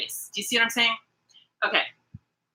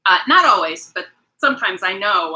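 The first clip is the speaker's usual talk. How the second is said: ordinary talk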